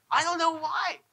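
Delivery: high, strained mock-helpless dad; exasperated dad.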